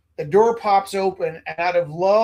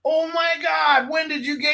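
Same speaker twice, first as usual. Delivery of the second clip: put-on half-shouted teenage excitement; excited teen; 10 semitones higher than usual. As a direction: yell it like a giddy kid in the back seat. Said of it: "you're like".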